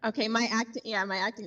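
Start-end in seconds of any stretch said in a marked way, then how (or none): none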